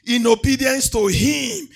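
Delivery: loud, chanting preacher's cry over music; thundering pulpit declaration of blessings; full-force preaching. Acted. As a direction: proclaim every blessing to the congregation at full force.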